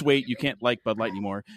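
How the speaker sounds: normal talking voice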